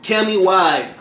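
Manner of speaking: ordinary delivery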